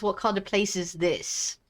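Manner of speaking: normal talking voice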